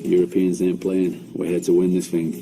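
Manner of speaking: ordinary delivery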